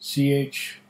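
ordinary talk, nothing marked

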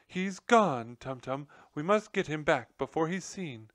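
high, loud and urgent; wailing plea. A narrator's reading. Nutmeg urging her husband to fetch the general, wailing high. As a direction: plead with rising alarm. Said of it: "Nutmeg wailed".

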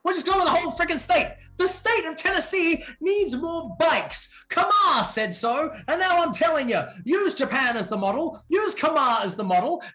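high, loud crowd-rousing announcer; hyped rallying shout. Pretend. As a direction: cheer them on like a rally speaker. Hyped cheering rally speech.

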